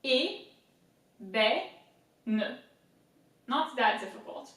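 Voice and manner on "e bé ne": "E, bé, ne" as separate French sounds; teacher sounding out a French word; syllable by syllable. Shown as a demonstration. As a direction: break ébène into slow, separate syllables. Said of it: "let's do it again".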